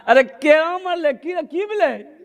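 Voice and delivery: high, hammed-up lament; drawn-out fake crying; mock wailing. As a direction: wail the question in mock grief. Acted mourners question weeping widow.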